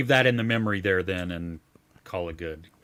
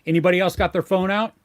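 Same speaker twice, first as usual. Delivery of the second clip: louder, higher challenge to the room; stern call-out; 5 semitones higher, 5 dB louder, faster than usual. Pretend. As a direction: call out the whole theater. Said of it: "and then you say".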